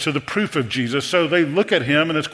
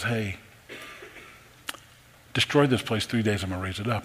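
softer, lower, gentle promise; quiet, tender; 5 semitones lower, 7 dB quieter than usual. Acted. Jesus telling the crowd of his resurrection, quiet and tender. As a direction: let it land hushed and sincere. Preacher softly voices Jesus.

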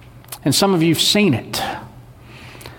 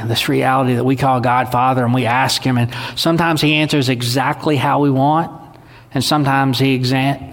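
ordinary delivery

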